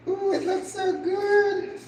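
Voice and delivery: high squeal of delight over the food; real excitement, squealed; excited. Real, not acted. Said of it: "I get so excited".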